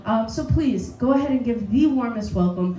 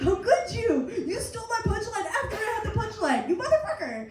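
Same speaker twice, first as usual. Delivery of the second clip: high-pitched, laughing indignation; hammed-up squealing accusation; mock outrage; 7 semitones higher than usual. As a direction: protest as if comically betrayed. Acted outrage at stolen punchline.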